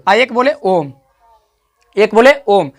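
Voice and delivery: "ओम" drawn out past a long vowel; extra-long vowel. Shown as a demonstration. Teacher comparing vowel timing for learners, extra-long "ओम". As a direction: stretch "ओम" into a hum. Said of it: "एकरो से ज़्यादा एकरा में लग रहा है".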